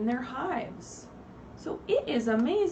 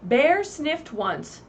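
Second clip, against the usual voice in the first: slow, louder read-aloud; storybook narration; 5 semitones higher, 7 dB louder, much slower than usual. A narrator's reading. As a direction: read it big and slow for the kids. Teacher reading picture book aloud.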